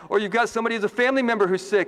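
ordinary talk, nothing marked

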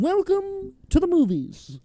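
high, hammy royal voice; pompous king voice. Pretend. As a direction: play a grand king welcoming guests. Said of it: "That's not how he sounded".

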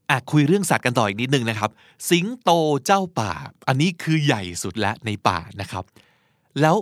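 normal talking voice

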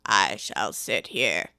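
very low, slow, indifferent drawl; a bored servant, low and slow; slow deep footman. Acted. Footman voice held.

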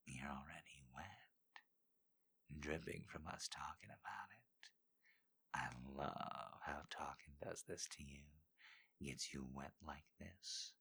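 Dean's whisper. Acted. Dean whispering to Maya in bed, hushed whisper. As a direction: whisper it close to her ear.

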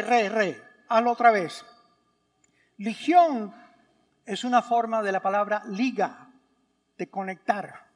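usual speaking voice

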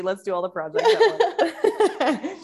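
high, slow, laughing send-up; mock-eager self-parody. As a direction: parody your own overexcited self. Mocking her own ADHD impulse.

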